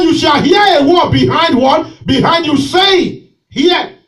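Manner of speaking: loud, driving preacher's call; fiery preaching. Real, not acted. Pastor preaching to his church, loud and driving. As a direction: preach it out to the congregation.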